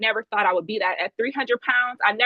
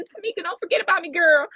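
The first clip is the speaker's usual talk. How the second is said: higher, quick and sing-song; playful pleading; 8 semitones higher than usual. Retold or imitated. Fan nudging a singer on Instagram, playful and high. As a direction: coax her like a friend.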